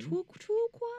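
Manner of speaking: put-on high-pitched babyish "true crime"; baby-talk echo. Host echoing her co-host's silly words, high baby talk. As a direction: squeak "true crime" with w's for r's.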